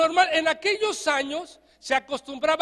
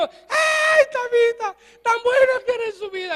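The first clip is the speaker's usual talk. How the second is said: high, loud hammed-up wailing; mock mourner wailing; 10 semitones higher, 5 dB louder than usual. Preacher mimics hired mourners wailing, high mock wail. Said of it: "Pero ahí estaba".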